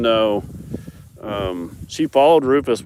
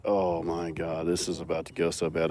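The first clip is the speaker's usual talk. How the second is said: dread under breath; 4 semitones lower, 8 dB quieter than usual. Retold or imitated. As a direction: mutter it like disaster is coming. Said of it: "And I'm like".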